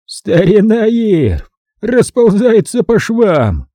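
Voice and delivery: slow, high, cackling delight; guffawing with glee. A narrator's reading. Hutchins crowing over Irv's downfall, slow guffawing glee. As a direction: roar it out through a hoarse guffaw. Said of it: "раздался его хриплый гогот".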